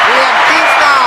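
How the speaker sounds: loud announcer-style call; announcer hype. Real, not acted. Streamer announcing a member like a ring announcer. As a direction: introduce him like a fighter.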